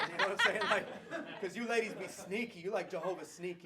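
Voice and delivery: high, loud, laughing through the words; cracking up while teasing the ladies. Real, not acted. Pastor teasing the wives in the room, laughing loudly.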